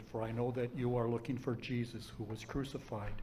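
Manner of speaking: ordinary talk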